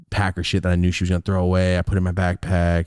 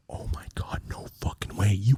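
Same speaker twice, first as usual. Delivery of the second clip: whisper; 7 dB quieter than usual. Retold or imitated. Stunned whisper watching mom.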